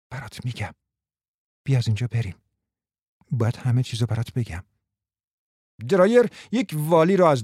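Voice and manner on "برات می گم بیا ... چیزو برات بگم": soft, secretive whisper. Acted. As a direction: whisper so no one hears.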